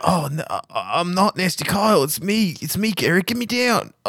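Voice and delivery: high, whiny bound prisoner; pleading captive. Acted. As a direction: beg to be untied. Gazebo keeps tied-up voice.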